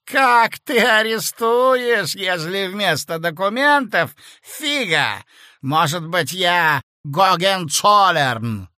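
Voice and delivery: loud, slow, desperate defiance; a cornered clerk shouting his nonsense; high-pitched half-mad protest; frantic Korotkov. Acted. Korotkov's frantic reckless quote.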